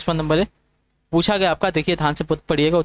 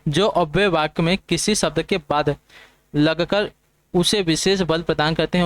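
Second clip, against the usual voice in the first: slow and deliberate, word by word; slow dictation. Shown as a demonstration. Teacher slowly dictating the definition to exam students. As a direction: read it out slowly so students can note it down. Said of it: "repeats definition slowly".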